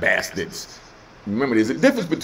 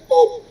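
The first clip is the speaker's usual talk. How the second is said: mock kick sound; 8 dB louder than usual. Cow kick sound in story.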